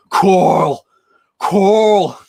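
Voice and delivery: loud, drawn-out American call; Rick Grimes impression. Streamer imitates actor calling his son, loud drawn-out yell. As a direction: holler the name as a mock American TV hero. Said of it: "Andrew Lincoln on The Walking Dead plays Rick Grimes".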